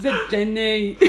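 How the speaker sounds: put-on film-hero voice, half laughing; Forrest Gump. Colleague teasing someone named Jenny, film impression. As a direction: imitate the film hero's simple question. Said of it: "You know, from Forrest Gump".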